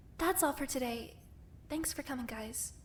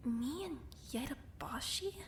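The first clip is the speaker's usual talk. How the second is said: breathless whispered disbelief; stunned whisper; 6 dB quieter, slower than usual. Acted. Learning of the forgotten meeting, a stunned whisper. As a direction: barely get the words out, in shock. Shocked at the video news.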